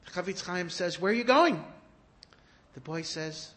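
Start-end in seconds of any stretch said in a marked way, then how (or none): 1.0-1.6 called out, higher and louder; calling after him. Acted. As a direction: call after the boy walking away. Calling after the departing boy.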